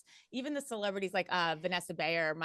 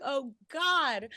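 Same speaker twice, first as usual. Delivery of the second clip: 2 higher and louder mock dread; 3 groan it as the chef melts down; 4 watching a chef melt down, mock horror; 1 dismayed exclamation; 7 semitones higher, 7 dB louder than usual. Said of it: "you're just like".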